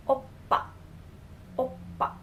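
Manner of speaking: "oppa" with a tight B sound in "pa"; careful "oppa", leaning toward B; B-like double consonant. Shown as a demonstration. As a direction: model "oppa" in two careful syllables, B-like second one. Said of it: "in pa has the B sound".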